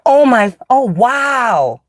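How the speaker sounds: high drawn-out so amazing; mock amazement. Mocking the awe people expect from her. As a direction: gush with slow fake awe. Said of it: "They want you to be like".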